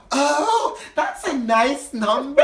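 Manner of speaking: put-on high, loud drunken gush; mock tipsy admirer, high and drawn out. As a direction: stretch it out in exaggerated delight. Tipsy woman gushes over him.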